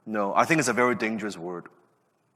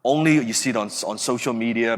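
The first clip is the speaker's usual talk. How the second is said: normal talking voice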